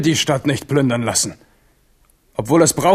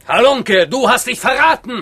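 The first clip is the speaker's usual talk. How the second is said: accusing shout; 7 semitones higher than usual. Acted. Crowd unmasks Biron.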